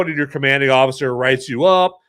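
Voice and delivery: ordinary talk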